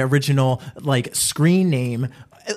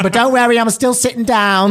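high, louder joking reassurance; mock grand reassurance, pitched up; 8 semitones higher, 5 dB louder than usual. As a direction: ham up the punchline. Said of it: "And she's like".

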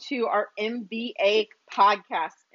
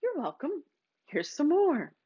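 lightly voiced universe, soft and higher; a hint of a generous cosmic voice; universe answering; 4 semitones higher, 4 dB quieter than usual. Universe answering a grateful person, gently higher. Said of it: "the universe goes".

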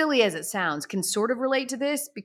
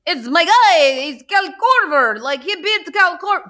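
high, loud, badly faked foreign accent; hammed-up protest in a botched accent; Giannis, horrible fake accent; 9 semitones higher, 7 dB louder than usual. Star upset for his teammate, horrible mock accent. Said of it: "how horrible this accent is".

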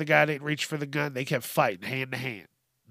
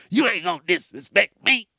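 louder, higher tough-guy threat with a put-on voice; mock gangster threat; 5 semitones higher, 5 dB louder than usual. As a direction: bark it like an offended shooter. Shooter quote repeated louder.

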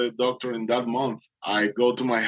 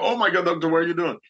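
alarmed; 6 semitones higher, much faster than usual. Retold or imitated. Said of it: "I was like".

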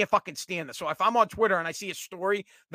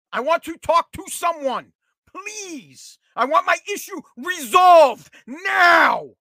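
slow frustrated shout; 8 semitones higher, 7 dB louder, much slower than usual. Real, not acted. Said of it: "venting, shouted slowly".

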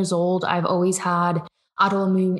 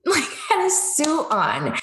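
high, drawn-out amused disbelief; laughing; 9 semitones higher, slower than usual. Real, not acted. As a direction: laugh through it, pitched way up. Laughing at her own story.

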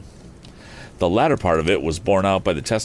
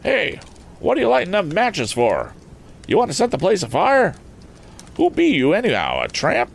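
high, raised rustic farmer voice; farmer shouting; 12 semitones higher than usual. Acted. Farmer challenging a stranger lighting matches, high raised shout. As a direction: call out a suspicious challenge as a country farmer. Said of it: "he heard a voice shouting".

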